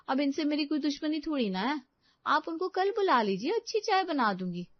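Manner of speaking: put-on high-pitched young wife making excuses; high, offhand excuse to mother-in-law; cheeky daughter-in-law. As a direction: protest innocence in a high voice. Story figure: daughter-in-law excusing herself.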